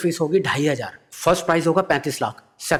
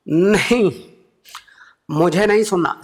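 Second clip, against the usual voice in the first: louder, higher refusal; Modi impression; 6 semitones higher, 3 dB louder, slower than usual. Modi refusing to hear the idea, firm. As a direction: shut him down firmly.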